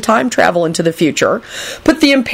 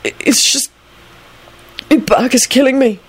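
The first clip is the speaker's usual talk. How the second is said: high, halting desperation; pained, faltering plea; desperate; 8 semitones higher than usual. A narrator's reading. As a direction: stammer it out in distress. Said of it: "Neville replied desperately".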